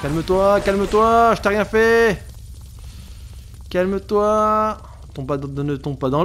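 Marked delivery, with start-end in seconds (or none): 0.0-4.8 raised, urgent pleading; pleading calm down. Real, not acted. Player begging an angry driver to calm down, raised pleading. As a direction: beg him to calm down, voice up.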